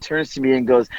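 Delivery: ordinary talk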